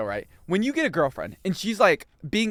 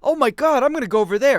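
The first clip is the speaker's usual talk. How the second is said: loud, high mock astonishment; astonished crowd; 9 semitones higher, 7 dB louder than usual. Retelling everyone's reaction to the stream, mock astonished. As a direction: imitate a crowd thrilled by the news. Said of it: "everyone was like".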